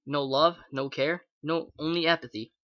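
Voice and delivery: usual speaking voice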